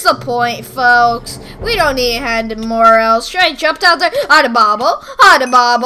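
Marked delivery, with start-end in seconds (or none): none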